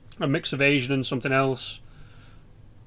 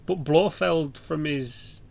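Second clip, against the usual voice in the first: ordinary talk, nothing marked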